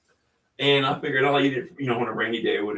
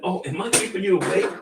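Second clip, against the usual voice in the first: higher, sing-song voice for the dog; pet talk; 8 semitones higher than usual. Real, not acted. Owner teasing his yawning dog Bailey, in high pet talk. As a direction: coo at a sleepy puppy.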